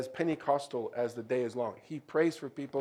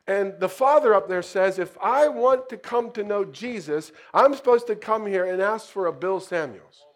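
raised voice; 7 semitones higher, 8 dB louder than usual. Retold or imitated. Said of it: "The guy on the motorcycle says".

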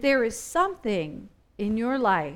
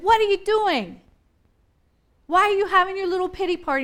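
raised, higher voice, louder, fast on the second line; Jesus rebuking; 7 semitones higher, 5 dB louder, faster than usual. Retold or imitated. Preacher voicing Jesus challenging the self-pitying, raised and loud. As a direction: call them out of their pity party. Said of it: "Jesus is like".

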